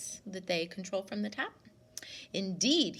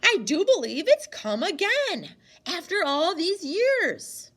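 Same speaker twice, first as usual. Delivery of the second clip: loud, higher awed exclamation; amazed; 8 semitones higher, 9 dB louder than usual. Acted. Grandmother recognising the music from the wood, amazed. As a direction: marvel that it has come back.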